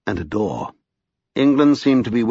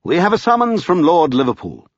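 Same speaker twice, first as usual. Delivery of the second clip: higher-pitched urgent announcement; excited cry; 7 semitones higher, 4 dB louder than usual. A narrator's reading. Lascelles announcing Lord Liverpool's summons, crying out excitedly. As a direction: announce it eagerly, voice raised. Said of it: "he cried".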